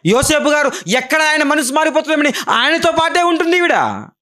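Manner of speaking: high, urgent preaching. Acted. Preacher telling Mary's hardship, high and urgent. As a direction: drive the story home.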